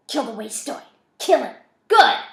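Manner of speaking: builds from taunt to loud scream; rising scream. A narrator's reading. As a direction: start cold, end screaming. Voice urges killing.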